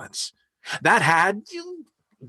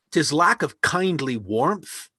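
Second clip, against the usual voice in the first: ordinary talk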